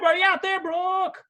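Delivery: high, loud calling out; hollering for someone out there; calling from far away. Acted. Calling out for the guest.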